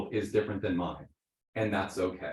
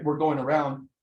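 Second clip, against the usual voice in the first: usual speaking voice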